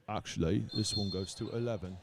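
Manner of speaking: put-on mild, so-so British accent; understated British accent. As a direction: quote the film in a light British accent. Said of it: "the only British accent I'll do tonight".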